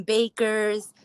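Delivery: usual speaking voice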